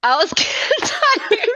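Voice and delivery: high laughing reply. Real, not acted. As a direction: laugh through the words.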